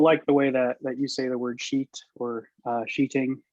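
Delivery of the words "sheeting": ordinary delivery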